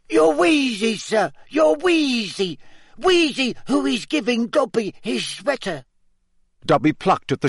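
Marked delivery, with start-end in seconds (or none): 0.1-5.8 very high, squeaky elf voice; squeaky house-elf. Acted. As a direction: squeak it like a tiny anxious elf. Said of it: "squeaked Dobby".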